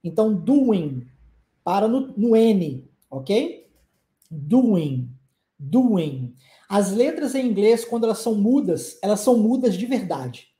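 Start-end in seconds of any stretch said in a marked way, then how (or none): 0.3-1.1 doing said as doin, no final G; doing ending on N; silent G. Shown as a demonstration. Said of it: "esse G no final nós não pronunciamos". 4.3-6.3 doing said as doin, no final G; doing ending on N; silent G. Shown as a demonstration. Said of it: "esse G no final nós não pronunciamos".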